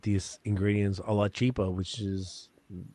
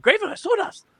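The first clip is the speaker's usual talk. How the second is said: a high, loud, hyped cry; excited shout; 7 dB louder than usual. Retold or imitated. To a podcaster at a meet and greet, excited high shout. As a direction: hype it up like a fan. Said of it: "I'm like".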